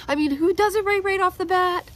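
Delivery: high, loud overwhelmed voice; panicked, near tears. Real, not acted. Freaking out over doing YouTube right, near tears. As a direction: voice climbing toward a sob.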